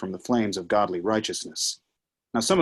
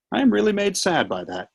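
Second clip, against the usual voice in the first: mock sadness; 12 semitones higher than usual. Satan mocks sorrow.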